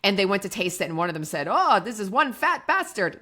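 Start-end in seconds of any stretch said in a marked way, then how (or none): 1.5-3.2 higher, louder mock Australian accent. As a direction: play the Australian winemaker tasting from the barrel. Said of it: "That's my Australian accent".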